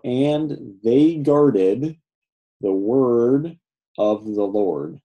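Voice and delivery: very slow, phrase by phrase; slow translating. Shown as a demonstration. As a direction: draw out each phrase, pausing before the next. Translating the Hebrew word by word.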